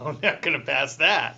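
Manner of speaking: slow, laughing through the words; laughing doubt. Retold or imitated. Pat hearing about the psychological test, laughing doubt. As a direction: chuckle it out in disbelief.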